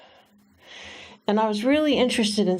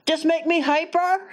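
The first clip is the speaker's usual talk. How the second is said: put-on little boy; 9 semitones higher than usual. Boy voiced in retold story.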